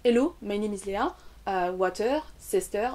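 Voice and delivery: English read evenly with French accent; French learner's English, no word or syllable stressed; flat French accent. Shown as a demonstration. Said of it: "Si vous parlez de façon plate".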